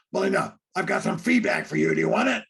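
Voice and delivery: mock-aggressive shout at a partner; loud, pushy offer. Husband offering his wife feedback, loud and pushy. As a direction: blurt it out loud, overeager to criticize.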